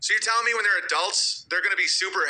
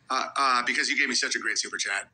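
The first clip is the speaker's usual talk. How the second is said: ordinary talk, nothing marked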